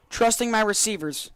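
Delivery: normal talking voice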